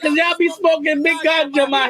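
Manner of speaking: put-on Jamaican accent. Teasing a man who was asked for a lighter, mock Jamaican accent. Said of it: "he didn't appreciate my Jamaican accent".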